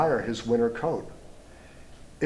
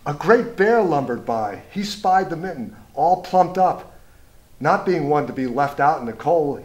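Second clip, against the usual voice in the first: louder, bigger read for the great bear; lively storybook reading; 3 semitones higher, 3 dB louder than usual. A narrator's reading. Reading to children, a lively storybook bear passage. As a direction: make the bear feel huge for the kids.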